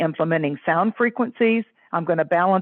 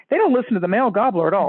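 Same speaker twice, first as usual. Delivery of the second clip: ordinary talk, nothing marked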